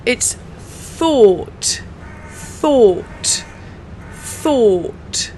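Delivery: "thought" repeated with a clear th and a crisp final t; model word. Shown as a demonstration. Teacher modelling "thought" for learners, repeated carefully. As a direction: say "thought" three times, tongue between teeth. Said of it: "It's pronounced like this".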